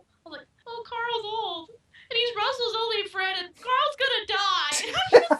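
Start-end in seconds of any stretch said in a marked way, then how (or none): 0.7-4.7 high, slow, laughing-through-tears lament; mock sobbing. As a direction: retell the sob with a laugh breaking in. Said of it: "I'm bawling".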